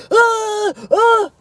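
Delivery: high, loud, hollow fake scream with no feeling; mock fake scream. Retelling the neighbor's fake scream to viewers, high and loud. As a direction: imitate a man screaming on purpose, emotionless. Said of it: "It was a fake scream".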